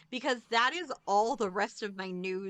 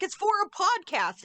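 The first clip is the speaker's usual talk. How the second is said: mock innocent excuse; 9 semitones higher, 4 dB louder than usual. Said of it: "I just needed to be like".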